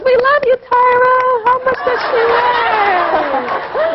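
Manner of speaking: high crowd cheer stretching into a very slow question; adoring audience. Retold or imitated. Host mimics her audience greeting her, high drawn-out cheer. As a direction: call out high like fans, dragging the end. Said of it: "the whole audience goes".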